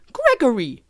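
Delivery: high-voiced British mum calling her child; hammed-up British mum; British accent. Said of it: "pioneers had British accents in that scene".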